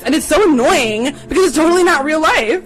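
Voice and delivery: loud, high-pitched student griping; med student complaining. Retold or imitated. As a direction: imitate an exasperated student's rant. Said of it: "the med student rocks up".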